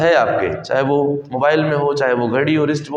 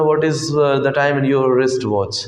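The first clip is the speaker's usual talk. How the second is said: slow example question; 3 semitones lower, much slower than usual. Shown as a demonstration. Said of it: "आपने ये नहीं पूछा था".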